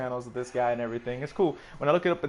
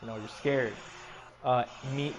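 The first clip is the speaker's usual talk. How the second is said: normal talking voice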